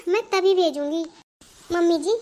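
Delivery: ordinary talk, nothing marked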